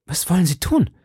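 a mild alarmed outcry; cried out. Acted. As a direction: stop him with a startled call. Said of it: "rief Madame Bonacieux".

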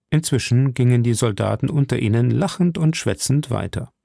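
ordinary delivery, nothing marked

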